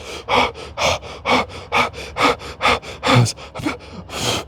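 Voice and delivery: long unvoiced rising breath; panicked breath out; long shaky breath. Sudden panic at the dishes.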